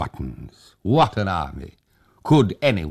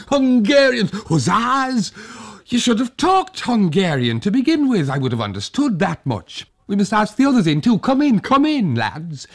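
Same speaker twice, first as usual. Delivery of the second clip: put-on high, loud old man's cry of relief; a delighted old man throwing up his hands; old Tag, relieved; 5 dB louder than usual. A narrator's reading. Old Tag calls to the hussars, high and relieved.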